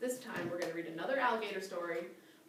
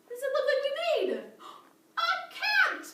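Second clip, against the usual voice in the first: much higher, fast, squealing guess; excited reveal; 6 dB louder than usual. Acted. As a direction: thrill the kids with the answer.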